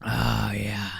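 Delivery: refreshed breathy whisper. Real, not acted. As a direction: sigh it out after a drink. Relieved sigh after a sip of water.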